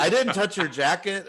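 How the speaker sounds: amused retelling of his defence; laughing protest. Retold or imitated. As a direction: let the laugh break into it.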